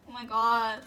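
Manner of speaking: ordinary talk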